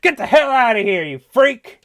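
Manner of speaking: high, loud hammed-up shout; mock yelling. Fly shop owner throwing out a customer, mock yelling. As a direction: explode at the customer as a furious shopkeeper. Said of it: "just yelling at him".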